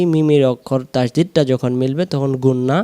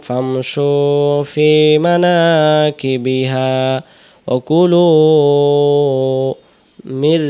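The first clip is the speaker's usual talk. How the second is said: slow, chanted Quran recitation with drawn vowels; 3 dB louder, much slower than usual. Shown as a demonstration. Teacher reciting the verse for students, slow and chanted. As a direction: recite the verse slowly, each vowel held.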